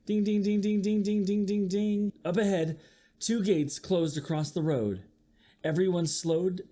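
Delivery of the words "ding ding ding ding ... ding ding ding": crossing bell. Acted. Reading a picture book aloud.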